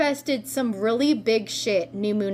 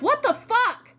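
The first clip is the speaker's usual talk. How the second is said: high-pitched, incredulous reaction; mock outraged disbelief; exasperated disbelief; 12 semitones higher than usual. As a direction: blurt it high, imitating baffled exasperation. Voicing everyone's exasperated reaction.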